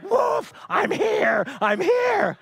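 high playful dog's voice, barking then answering; eager dog answering its owner. Retold or imitated. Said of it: "And he's like".

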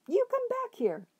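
very high yelling cry; shouted. A narrator's reading. Ernest chasing the dog, high shrill yell. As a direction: bellow after the running dog. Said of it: "Ernest shouted".

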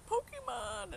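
put-on high squeal of a thrilled four-year-old; imitating the son's delighted shout; excited kid. Mom voicing her son spotting the Pokemon machine, high excited squeal. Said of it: "he's like".

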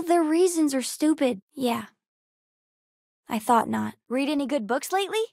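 ordinary talk, nothing marked

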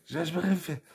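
ordinary delivery

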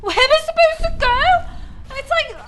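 high, loud and hurried; frantic at the dead end; real panic rising to a shout; panicked fast yelling. Real, not acted.

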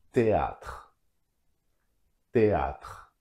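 "Théâtre" with silent h, e and a separate; French pronunciation. Shown as a demonstration. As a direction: say "Théâtre" as té-atr, ending on a French tr. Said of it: "H is not pronounced in French".

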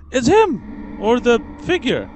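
high, loud excited cry; excited shout. Acted. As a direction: blurt it out in alarm. Sees the ghost.

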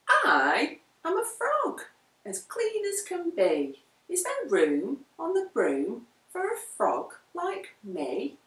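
a cheerful frog begging a ride; lightly croaky frog voice; frog with a croak. A narrator's reading. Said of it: "said with a croak".

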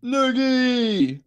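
joyful call-out; excited cheer. Real, not acted. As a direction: shout the name with glee. Cheering a character's entrance.

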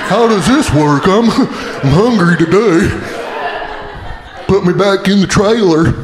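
put-on peanut-butter-mouthed horse voice; talking horse. Horse fed peanut butter speaks, dopey horse voice. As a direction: talk as a horse working its sticky mouth. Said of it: "get a horse to talk".

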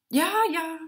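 high-pitched goblin voice; high, eager character voice; little round goblin. A narrator's reading. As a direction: chime in high as the small fat goblin. Round goblin Mussmann introduces himself.

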